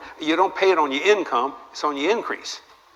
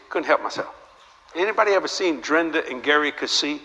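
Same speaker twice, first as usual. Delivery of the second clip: ordinary delivery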